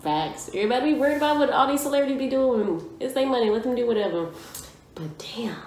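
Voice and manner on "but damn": awed whisper. Real, not acted. Awed by the song.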